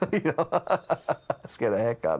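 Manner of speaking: laughing through the words. Real, not acted. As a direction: break into real laughter. Laughing at the prank.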